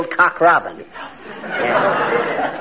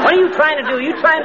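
high indignant protest; 10 semitones higher, faster than usual. Acted. McGee protests the accusation.

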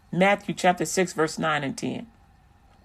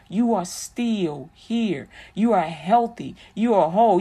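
drawn-out, soaring sermon lines; slow emphatic preaching; 3 semitones higher than usual. Acted. Pastor slowly encouraging Jada, drawn-out emphatic preaching. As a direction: preach each word slowly like a pastor lifting her up.

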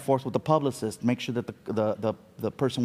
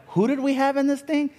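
amazed; 13 semitones higher than usual. Retold or imitated. Producers amazed at table read.

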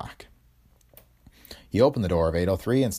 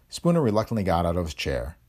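ordinary delivery, nothing marked

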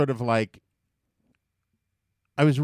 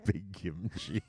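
laughing; 5 semitones lower, 12 dB quieter, slower than usual. Real, not acted. Laughing echo of phrase.

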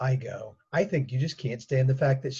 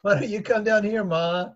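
raised, higher calling voice; 7 semitones higher, 4 dB louder than usual. Acted. Daughter answering her mother upstairs, calling out. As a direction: call up the stairs.

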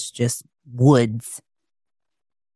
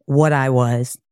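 plain reading voice

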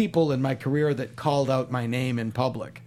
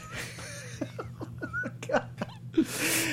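helpless high laugh; 8 dB quieter than usual. Real, not acted. Laughter after punchline.

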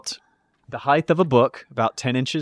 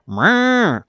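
high little meow like a cat; 8 semitones higher than usual. Retold or imitated. Glitter bomb device surprising the thief, a lightly voiced cat meow. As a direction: mew like a kitten from inside the box. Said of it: "you hear".

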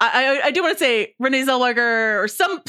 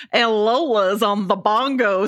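slow, put-on mock Southern accent; Catcher Block accent; slower than usual. Host mimics the actor's bad accent, slow mock Southern. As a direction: imitate a bad movie Southern accent, drawn out. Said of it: "Catcher Block accent where he's like".

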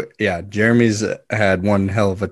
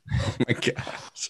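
whispered; 8 dB quieter, slower than usual. Real, not acted. Reacts to harsh review.

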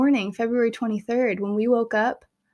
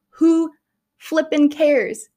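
higher, louder, slow and drawn out; emphatic dismissal; 7 semitones higher, 5 dB louder, much slower than usual. Real, not acted. Telling pregnant viewers imperfect births don't matter, emphatic and slow. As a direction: throw it away, emphatic and slow. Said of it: "who flippin' cares".

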